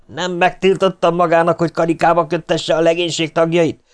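high, furious tirade; raging officer. A narrator's reading. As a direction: dress down a subordinate in a rage. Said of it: "Az őrnagy valósággal tombol dühében".

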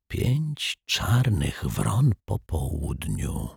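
soft, very slow, pensive murmur; hushed murmur. A narrator's reading. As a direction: murmur it slowly, as if musing. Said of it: "szepnął detektyw Pozytywka". (cichy, powolny, zamyślony półszept)

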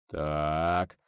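low, dull, drawn-out word; muffled menace. A narrator's reading. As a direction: let a threat rumble under it. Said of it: "в глухом голосе начальника базы рокотнула угроза". (глухо, с угрозой)

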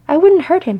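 loud, high protest. Acted. Glóin defending himself to his wife, protesting. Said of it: "he protested".

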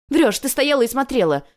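high, loud contradiction; furious accusation. A narrator's reading. Girl calls her rival a liar, furious. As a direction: hurl the charge at her.

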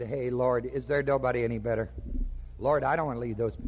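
reluctant Joshua. Acted. Voices reluctant Joshua answering God.

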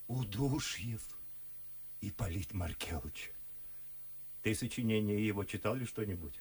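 ordinary delivery

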